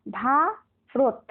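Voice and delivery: ভারত cut in two, ভা, then রত after a pause; two slow separate beats, ভা - রত, in ভারত; split into syllables. Shown as a demonstration. Teacher shows pupils the proper cut, two syllables apart. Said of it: "কেটে কেটে উচ্চারণ করি".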